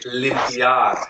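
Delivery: limpiar said slowly, stressed on final AR; end stress. Shown as a demonstration. Teacher prompting students to repeat limpiar, slow, end-stressed on AR. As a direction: stretch limpiar and land on lim-pi-AR. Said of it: "putting the stress at the end".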